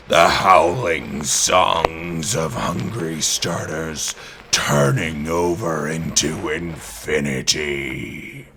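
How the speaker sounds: ordinary delivery, nothing marked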